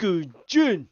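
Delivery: loud, high karate count in Japanese; hammed-up Japanese dojo counting; mock Japanese. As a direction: punch out the Japanese numbers to a big finish. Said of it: "all I can do in Japanese".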